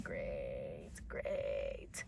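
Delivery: groggy sarcasm at the noisy roommate; sarcastic great. Retold or imitated. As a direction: mutter it with dead sarcasm.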